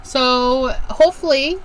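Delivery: normal talking voice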